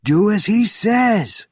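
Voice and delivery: high, loud, slow yell; fierce commanding shout; roared command. A narrator's reading. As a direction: roar the order with stretched words. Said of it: "roared Benjamin's voice".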